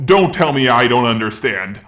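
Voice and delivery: ordinary talk, nothing marked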